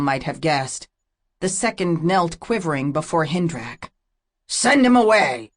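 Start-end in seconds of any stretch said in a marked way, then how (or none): 4.5-5.5 higher, louder curt order; a warrior's loud command. A narrator's reading. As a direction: bark the order at the druid. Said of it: "Drelm commanded the druid".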